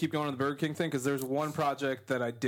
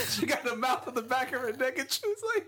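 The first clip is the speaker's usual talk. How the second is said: laughing; 8 semitones higher than usual. Real, not acted. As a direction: joke told through laughter. Reciting a comedian's joke.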